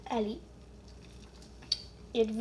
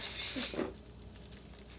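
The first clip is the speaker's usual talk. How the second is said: quiet unvoiced giggle; breathy laugh; 10 dB quieter than usual. Real, not acted. Laugh after the line.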